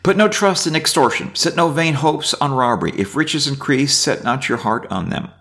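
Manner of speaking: reading scripture aloud. Acted. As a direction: read the psalm out to the class. Reads psalm aloud.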